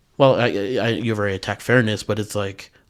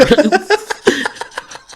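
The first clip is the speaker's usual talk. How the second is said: loud high laugh; 6 dB louder than usual. Real, not acted. Laughing at the joke.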